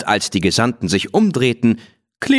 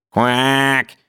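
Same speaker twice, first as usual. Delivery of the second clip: higher, called-out duck "Quack"; 3 semitones higher than usual. A narrator's reading. Willi answering the sheikh with a called-out duck quack. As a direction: call "Quack" like a cheeky duck. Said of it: "rief Willi".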